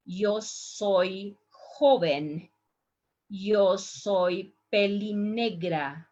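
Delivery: slow, clear model sentences; slow model reading. Shown as a demonstration. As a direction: read each line slowly for students. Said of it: "listen the pronunciation".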